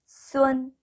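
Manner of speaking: "수원" high and level; pronunciation demo. Shown as a demonstration. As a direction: model "수원" as one high, even syllable. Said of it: "이렇게 발음하시면". ("수원"을 높고 평평하게 읽는 발음 시범)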